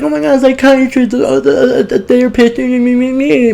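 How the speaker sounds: high, whiny mock voice about therapy; mocking self-absorbed friend. Mocking friends who avoid therapy with a high whine. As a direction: whine it like someone who won't seek help. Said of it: "A lot of you bitches be like".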